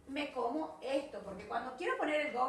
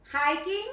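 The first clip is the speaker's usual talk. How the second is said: hiking said louder and higher to repeat; model word; 4 semitones higher, 7 dB louder than usual. Shown as a demonstration. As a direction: say hiking clearly for the class to echo.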